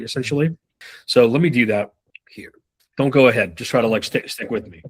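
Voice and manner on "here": whisper. Real, not acted. Whispered aside.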